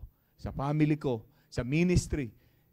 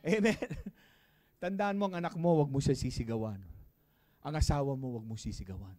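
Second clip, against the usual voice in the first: normal talking voice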